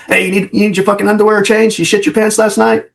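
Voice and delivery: high teasing jab, a bit fast; mocking boss. Boss razzing his officer at briefing, high mocking tease. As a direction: needle him like a crude boss.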